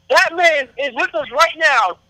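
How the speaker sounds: high, drawn-out announcer build on the name; ring announcer introduction. Real, not acted. Host presents wrestling guest to listeners, ring announcer introduction.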